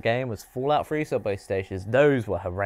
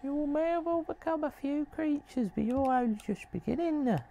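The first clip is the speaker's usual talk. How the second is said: creepy hunter figure; 5 dB quieter than usual. Acted. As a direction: tempt the player to the hunt. Voices game character.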